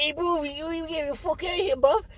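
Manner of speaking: high, loud, cartoonish British robot; British mock accent. Co-host voicing C-3PO, over-the-top British accent. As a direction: ham up a London geezer accent. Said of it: "Oi, I'm British".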